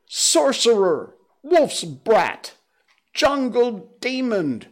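high, loud angry mob cries; shrill mob yelling stones at the boy; villagers shouting. Acted. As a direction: yell them out like a furious crowd. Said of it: "the villagers shouted".

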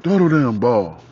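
loud impatient shout; father yelling back. Retold or imitated. Dad yelling at his son on the mound, loud and impatient. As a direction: snap it out like an annoyed dad. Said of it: "He's like".

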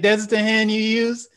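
can't believe what you see; incredulous. Retold or imitated.

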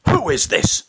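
imitated dad's indignant outburst; angry shout. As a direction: demand it like an irate father. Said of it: "I hear him angrily shout".

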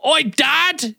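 high mock-Cockney call; bad Cockney accent. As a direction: hail Dad in fake London accent. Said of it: "I can't do Cockney".

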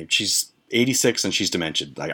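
usual speaking voice

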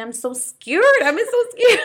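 high, loud wail breaking into laughter; hammed-up scared cry, climbing sharply in pitch; mock tearful panic. Friend retelling her fear of illness, mock tearful panic. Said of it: "like, hysterically crying".

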